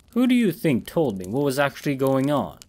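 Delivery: ordinary delivery, nothing marked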